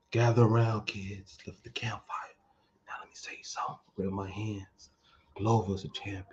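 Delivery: whispered campfire story voice; hushed creepy legend telling. Pretend. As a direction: hush it like a ghost story. Campfire legend telling about Glover.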